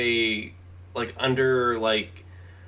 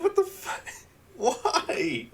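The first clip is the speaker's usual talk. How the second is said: high-pitched incredulous wail with a laugh; retold disbelief; 11 semitones higher, 4 dB quieter, slower than usual. Viewer retelling reaction to a bad movie romance, retold disbelief. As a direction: replay your disbelief, pitched way up.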